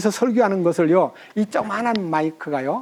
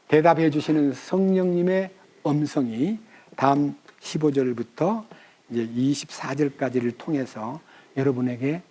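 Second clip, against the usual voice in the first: normal talking voice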